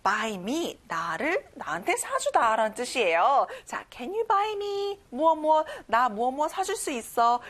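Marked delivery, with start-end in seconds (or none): 3.9-5.0 slow, drawn-out model question; model phrase. Shown as a demonstration. Teacher modelling a question slowly, stressing buy. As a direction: stretch it out slowly for learners. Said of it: "이렇게 한번 외워보세요".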